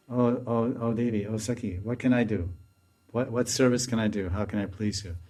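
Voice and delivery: soft, tender offer of service; hushed, devoted asking; humble servant's plea. Acted. Acts maidservant addressing her mistress.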